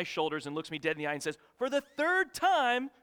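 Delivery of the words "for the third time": loud, high, drawn-out exasperated outburst; hammed-up parental yell at full volume; exasperated dad. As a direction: imitate a fed-up dad shouting the answer, slowly and emphatically. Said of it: "turns around violently".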